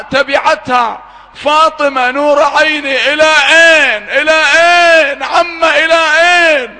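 high, loud, wailing sermon delivery; full-force mourning sermon; preaching at full force. Acted. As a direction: cry it out to move the hall to tears. Preacher voices Zaynab chasing Fatima.

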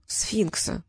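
soft, meek confession; timid babble. A narrator's reading. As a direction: own up in a small, timid voice. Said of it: "пролепетал Трикс".

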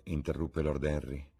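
ordinary delivery, nothing marked

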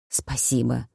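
low and soft, near a whisper; hushed, low-pitched gratitude; whispered thanks. A narrator's reading. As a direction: murmur it weakly under your breath. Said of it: "прошептал Эд".

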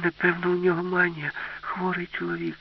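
softening, high-pitched concerned thought; worried girl. Acted. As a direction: muse anxiously as a child. Girl worries about grandpa.

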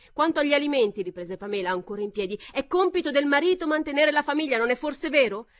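high, loud argument; insistent loud. A narrator's reading. Pamela arguing over the crowd, loud. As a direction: press the point.

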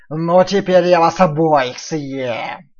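slow, loud menacing wolf. Acted. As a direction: threaten slowly and loudly. Wolf threatens.

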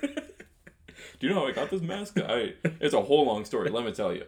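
lightly put-on voice, starting high; Doctor Vader. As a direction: launch a rambling comic confession. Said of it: "thinking of with this voice".

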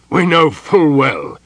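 loud, high, scornful retort; angry general's rebuttal; bitter, angry. A narrator's reading. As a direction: throw the claim back bitterly. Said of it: "gave a bitter, angry laugh".

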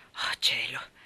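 low, wistful exclamation; a sighing old friend envying a new love; regretful sigh. Acted. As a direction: let the regret show. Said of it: "esclamò con un certo rimpianto".

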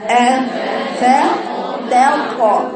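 آ فا تا طا مقطعة ببطء; قطّع أفتطمعون مقطعًا مقطعًا; syllable demo. Shown as a demonstration. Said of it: "يبقى أقرأها إزاي". (تقطيع تعليمي للكلمة)

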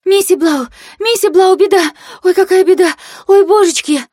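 put-on high-pitched, frantic servant girl; panicked gabbling maid. A narrator's reading. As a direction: cry the alarm in a squeaky panic. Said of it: "и сразу затараторила". (испуганная служанка, тараторит тонким голосом)

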